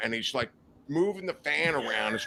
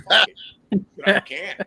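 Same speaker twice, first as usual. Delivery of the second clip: louder, higher burst of giving up; retold exasperation; 5 semitones higher, 7 dB louder, slower than usual. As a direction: throw it out loud, near laughing. Retold giving up, then laughs.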